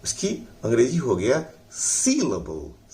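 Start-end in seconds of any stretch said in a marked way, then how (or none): 1.7-2.9 "syllable" slow, heavy first part, light ending; stress on SIL. Shown as a demonstration. Teacher models the English term, "syllable" stressed on syl. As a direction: say "syllable" leaning on "syl", ease off "able". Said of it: "सील पर जोर लगाइए और अबल को हल्का".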